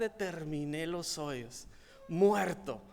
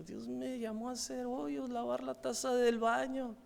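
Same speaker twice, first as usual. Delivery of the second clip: ordinary talk, nothing marked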